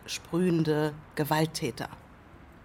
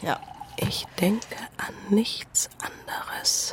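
langsames, tonloses Flüstern; geflüstert; 3 semitones higher, slower than usual. Acted. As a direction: leise und verschwörerisch flüstern. Whispered aside during sketch. (flüsternd, langsam)

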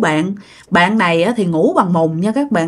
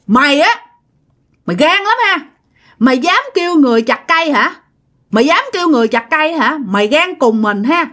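angry scolding ghost; 6 semitones higher, faster than usual. Retold or imitated. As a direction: threaten her ferociously. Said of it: "bà chửi bạn quá trời luôn".

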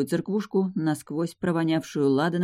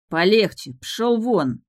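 irritated, louder command; angry rebuff; 5 semitones higher, 4 dB louder, slower than usual. Acted. Groom pushing off the bride's brothers, angry. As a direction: shove them off with your voice. Groom shoves the guards away.

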